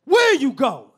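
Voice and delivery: high, loud catcall to a man; loud catcalling woman. Retold or imitated. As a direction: holler at him across the park. Mom catcalling dad.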